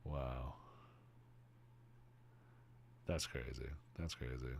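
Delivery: low, soft, stunned; awed murmur. Real, not acted. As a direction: murmur it, overwhelmed. Awed after finding clip.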